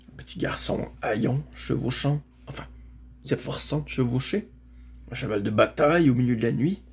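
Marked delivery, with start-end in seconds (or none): none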